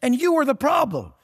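rising, high-pitched scolding rant; heated scolding. Real, not acted. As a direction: pitch jumps high, jabbing at him. Ranting at miserable rich men.

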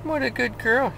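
doggy praise voice. Real, not acted. Owner praising the dog in the car, high cooing sing-song. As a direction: coo over her like a proud owner.

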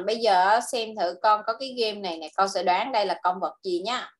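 ordinary talk, nothing marked

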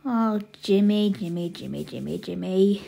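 low, despairing repetition; exasperated, softening lament; weary dismay. Acted. Exasperated big sister sighing over brother.

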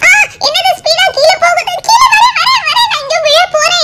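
very high, frightened cries as she drops fast; falling scream. Acted. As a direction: shriek it out like a child plunging down. (வேகமாக கீழே விழும்போது மிக உயர்ந்த பயந்த அலறல்)

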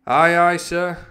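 high, loud sailor's reply; cook answering captain. A narrator's reading. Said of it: "answered the cook".

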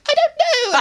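very high squeaky voice with a laugh; Alpha Centauri voice. Retold or imitated. Alpha answering Omega, very high laughing squeak. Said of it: "I'm both characters".

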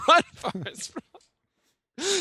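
laughing quote. Retold or imitated. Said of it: "Forrest Gump quote".

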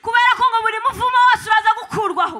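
loud, rousing interpreted sermon; preaching. Acted. Interpreter preaching to the congregation, rousing and loud. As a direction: preach it out to the crowd.

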